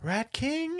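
high, rising, baffled; confused rat question. Retold or imitated. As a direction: ask it like a puzzled rat. Rats question the blob.

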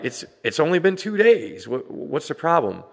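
high-pitched imitation of clueless bystanders; mocking dismissive people. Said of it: "people are like".

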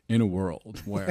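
slow, dramatic movie trailer attempt; tentative go at an epic announcer. Shown as a demonstration. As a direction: draw it out like a trailer narrator. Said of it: "You have, like, the greatest trailer voice".

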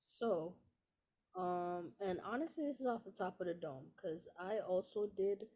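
distant, off-mic voice; far from mic. Real, not acted. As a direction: sounds far from the microphone. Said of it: "you sound, like, mad far away right now".

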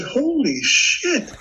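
high, slow drawn-out holy shit; stunned exclamation. Retold or imitated. Shot man realizing the damage, stunned. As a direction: stretch it out in shock. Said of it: "I said".

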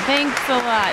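usual speaking voice

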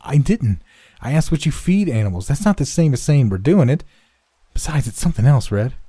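put-on high, emphatic boy's denial; lifted, insistent self-defence; defensive protest. A narrator's reading. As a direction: argue back at your accusing friend. Boy defends himself in quarrel.

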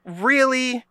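high-pitched mock disbelief; sarcastic. Podcaster answering co-host's sarcasm complaint, sarcastically. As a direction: feign surprise with a high lilt. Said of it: "being actually sarcastic".

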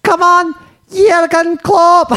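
high, drawn-out Scouse terrace cry; very high Scouse send-up; fake Scouse fan. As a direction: cheer on the manager in mock Scouse. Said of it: "I'm gonna go undercover in the away end".

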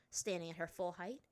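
usual speaking voice

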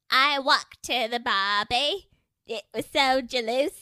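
a mock British voice that sounds like her Scottish; bad British accent. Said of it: "here's my British".

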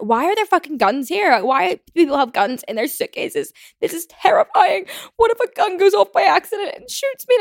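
high-pitched, rising alarm; acted panic. Acting out panic at gun signs.

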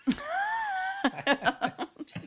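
a burst of high laughter at his own joke. Real, not acted. Guest laughs at his own [name] joke, high laughter.

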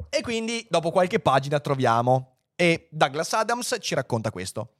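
plain reading voice

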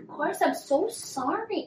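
imitating Shanae's syrupy apology to Clayton; mock sweet apology. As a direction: play it sugary and fake. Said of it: "she's like".

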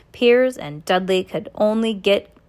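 ordinary delivery, nothing marked

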